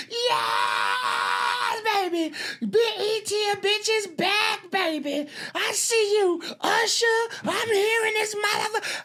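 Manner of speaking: very high, shouting, laughing joy; imitating a host's high, laughing shout; excited screaming host. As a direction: scream it out like a host hyping the crowd. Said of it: "She's screaming and yelling".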